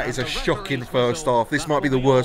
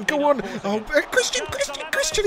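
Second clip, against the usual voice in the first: very high-pitched repeated name; frantic urging; 13 semitones higher than usual. Real, not acted. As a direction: squeal the name as he breaks through. Player breaking through.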